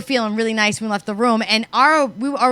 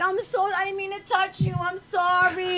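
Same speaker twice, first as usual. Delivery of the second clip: put-on drunk apology; 7 semitones higher, 9 dB quieter than usual. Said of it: "I'm like".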